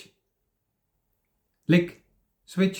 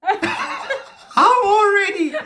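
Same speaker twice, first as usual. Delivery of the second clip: laughing; 12 semitones higher, much slower than usual. Real, not acted. Laughing during game.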